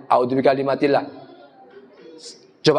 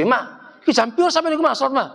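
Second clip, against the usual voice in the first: a hint of a casual husband asking the time; pitched up, quick, offhand question; lightly voiced husband; 9 semitones higher, faster than usual. Husband asking wife the hour, lightly voiced and offhand.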